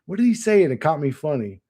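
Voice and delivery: ordinary talk, nothing marked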